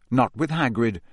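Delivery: plain reading voice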